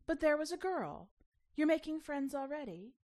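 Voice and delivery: mother pressing her daughter about a new friend, eager delight. Acted. Mommy thrilled at news.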